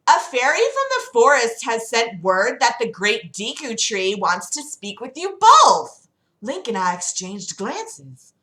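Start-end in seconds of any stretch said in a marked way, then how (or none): 0.1-6.1 lightly voiced Valley Girl, a little higher and louder. Zelda passes on the tree's summons, Valley Girl voice. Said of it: "she's a Valley Girl".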